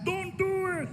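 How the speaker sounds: a lightly voiced high hushed warning; a hint of a worried friend backstage. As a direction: warn him off from behind the curtain. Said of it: "I can hear him in the back".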